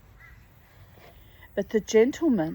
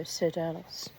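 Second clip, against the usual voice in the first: plain reading voice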